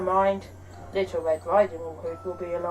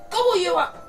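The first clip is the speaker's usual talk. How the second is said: sudden loud, high wolf roar; explosive storybook roar; 9 semitones higher, 6 dB louder than usual. A narrator's reading. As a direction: pounce on the words as the hungry wolf. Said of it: "roared the wolf".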